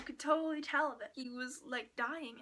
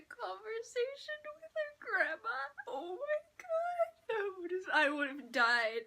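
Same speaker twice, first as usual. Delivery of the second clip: fangirl squeal; 9 semitones higher, 5 dB louder than usual. Real, not acted. Emotional fangirling, cry heard.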